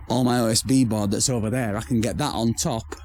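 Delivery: ordinary talk, nothing marked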